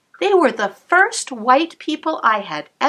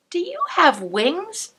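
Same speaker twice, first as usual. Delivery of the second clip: put-on wondering child; 8 semitones higher than usual. Child asks angel wonderingly.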